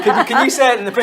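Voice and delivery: ordinary delivery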